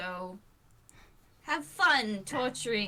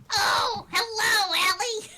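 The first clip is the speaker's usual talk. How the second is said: put-on higher, louder sweet old-lady greeting; grandma voice; 10 semitones higher, 7 dB louder than usual. Shown as a demonstration. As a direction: greet the child like a doting granny. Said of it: "just give her a voice".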